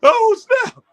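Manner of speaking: high, loud "oh snap" in disbelief; excited exclamation. Real, not acted. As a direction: blurt it out, overwhelmed by the dunk. Reacting to the dunk.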